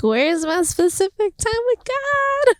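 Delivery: high, slow, whining voice; whiny self-mockery. Mock whiny quote to God.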